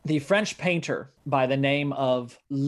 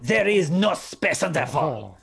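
louder, hammed-up French accent; mock French accent; 2 semitones higher, 5 dB louder than usual. Retelling the painter's words, now in a put-on French accent. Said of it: "in a French accent".

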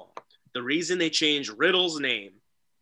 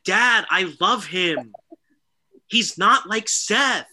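higher and slow, lightly voiced pleading teen; mock whiny daughter; 5 semitones higher, 4 dB louder, slower than usual. A hint of Aaliyah begging her father on live TV. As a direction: plead with dad like a naive teenager. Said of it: "she's just said".

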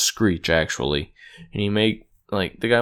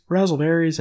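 catchphrase; 7 semitones higher, 4 dB louder than usual. Retold or imitated.

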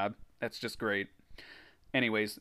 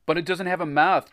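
mildly alarmed, raised voice; mild shudder; 5 semitones higher, 5 dB louder than usual. A narrator's reading. Jack shuddering at the mouthless blob, mildly alarmed. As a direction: protest in uneasy disbelief. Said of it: "Jack shuddered".

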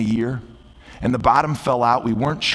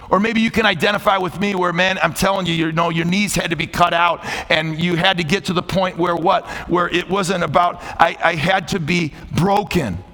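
raised, high sermon delivery; impassioned preaching appeal; 5 semitones higher than usual. Real, not acted. Pastor asking listeners about their decision, impassioned preaching. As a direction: press the congregation, pitched high and sustained.

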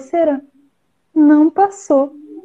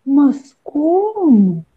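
slow rhino voice; 7 semitones higher than usual. Acted. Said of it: "rhino answers bird".

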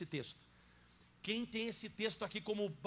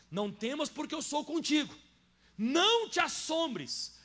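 much higher and louder, stretching assombres slowly; proclaiming loudly; 8 semitones higher, 8 dB louder, slower than usual. Acted. Pastor to the congregation, a loud soaring proclamation. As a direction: thunder the promise out. Preacher proclaims verse at full force.

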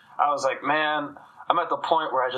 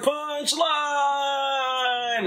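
yelled punchline; 11 semitones higher than usual. Retold or imitated. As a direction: yell it over the music. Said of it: "had to yell my punchline".

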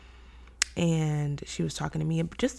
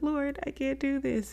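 overwhelmed plea; 10 semitones higher than usual. Retold or imitated. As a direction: whimper it upward, half laughing at yourself. Retelling her overwhelmed plea to God.

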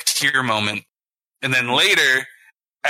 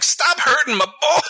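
1 high mock yell; 14 semitones higher than usual. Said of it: "and I'm like".

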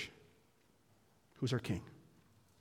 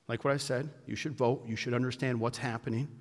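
usual speaking voice

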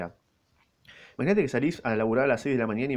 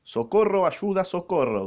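high, slow call of help, mock panic; a hint of a scared servant shouting for help; mild cry for help; 7 semitones higher, 4 dB louder, slower than usual. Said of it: "gritó". (pedido de auxilio asustado, a medias)

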